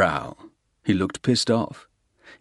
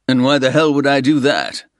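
louder, higher furious challenge; angry demand; 7 semitones higher, 7 dB louder than usual. Acted. Soames angrily challenging the Russian. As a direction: throw it back at him.

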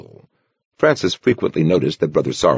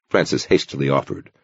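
normal talking voice

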